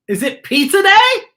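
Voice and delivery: put-on high, loud, eager kid calling out; excited shout. A narrator's reading. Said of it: "Mikey shouts out".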